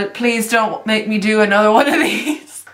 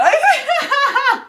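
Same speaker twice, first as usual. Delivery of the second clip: much higher burst of laughter. Real, not acted. Seeing her ghoul face, a high laugh bursts out. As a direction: crack up at yourself.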